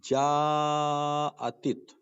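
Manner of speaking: jaa held very long, then attit; long madd. Shown as a demonstration. Teacher reads the example word to students, long madd on جاءتت. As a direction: draw out the jaa in جاءتت on a level note. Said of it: "مدہ زبر کو separate پڑھتے ہیں".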